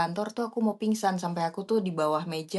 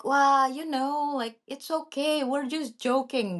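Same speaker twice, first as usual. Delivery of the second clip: mocking boss; 8 semitones higher, much slower than usual. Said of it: "they're like".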